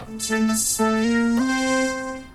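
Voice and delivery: ordinary talk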